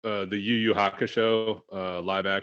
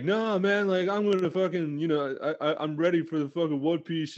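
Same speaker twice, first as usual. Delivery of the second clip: hyped imitation; 6 semitones higher than usual. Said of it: "You were like".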